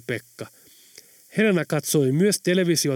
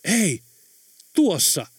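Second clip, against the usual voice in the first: mild narrated shout, higher pitched; exclaimed shout; 5 semitones higher, 3 dB louder, slower than usual. A narrator's reading. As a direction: call out sharply but contained. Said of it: "Pekka karjaisi". (hillitty karjaisu)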